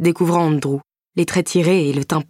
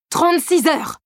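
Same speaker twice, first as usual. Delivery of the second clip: high furious yell; angry husband yelling; 12 semitones higher than usual. A narrator's reading. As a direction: shout it out in rage. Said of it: "hurla-t-il".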